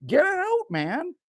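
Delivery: high, rising mock-panicked plea; mock urgent cry. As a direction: playfully cry out, jumping way up in pitch. Mock reaction to snake in boot.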